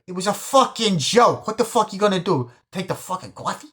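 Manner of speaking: high, loud exasperated yell; mock angry yell. Pesci yelling about the coffee, angry exasperated yell. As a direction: rant at him over the coffee.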